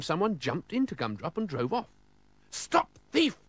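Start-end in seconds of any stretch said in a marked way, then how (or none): 2.5-3.4 high, loud shout after the thief; cried out. Acted. Mister Oldcastle shouting at the car thief, high loud cry. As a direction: yell it as the car drives off. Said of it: "cried Mister Oldcastle".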